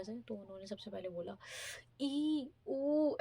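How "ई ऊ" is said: imitating a squeamish "ई, ऊ", pitched up; a grossed-out little groan, put on; mock disgusted ew. Retelling the Korean interviewer's disgusted reaction to India, mock disgust. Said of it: "ऐसे करके, ऐसे बिल्कुल same expression था".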